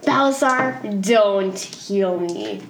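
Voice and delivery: drawn-out, slow plea in character; very slow, emphatic delivery; slow insistence. Acted. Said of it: "I'm still like".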